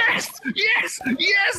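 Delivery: high excited yes, yes, yes; ecstatic shout. Acted. Comedian cheering the crowd, ecstatic shout. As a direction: cheer it out like a climax. Crowd rubbing barrier climax.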